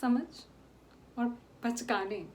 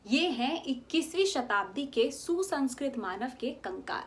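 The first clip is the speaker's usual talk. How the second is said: usual speaking voice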